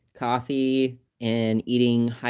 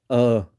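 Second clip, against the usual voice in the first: mock groan of disgust; 6 dB louder than usual. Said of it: "we're just like".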